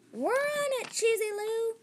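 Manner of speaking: calling out. A narrator's reading. Cow mice answer Cheesy Lou, calling out high. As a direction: shout it across the street. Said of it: "they called".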